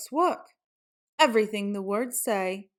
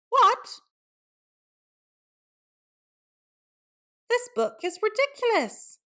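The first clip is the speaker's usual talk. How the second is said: outraged squeak; 9 semitones higher than usual. Acted. Reader appalled by the silly song, high squeal. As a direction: squeal in disbelief.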